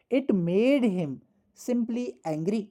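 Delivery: each word laid out very slowly; slow dictation. Shown as a demonstration. Teacher dictating the example sentence slowly to students. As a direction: dictate the sentence at writing speed.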